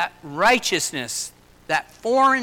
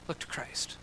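hushed whisper; 4 semitones lower, 14 dB quieter than usual. Acted. Quoting God's plea softly.

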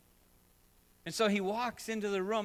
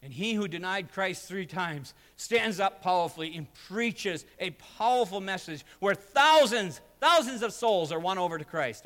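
building preaching; 3 semitones higher, 7 dB louder than usual. Acted. Pastor telling of Peter's bold sermon, swelling preaching. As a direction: lift the room with Peter's story.

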